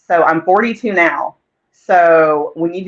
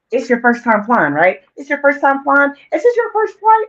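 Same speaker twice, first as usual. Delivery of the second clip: lightly voiced, rising higher, pestering old aunt; imitating great aunt; 7 semitones higher than usual. Her senile great aunt nagging on the flight, mild imitation. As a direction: mimic a confused aunt asking over and over. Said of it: "And she kept saying".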